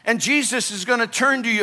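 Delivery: ordinary talk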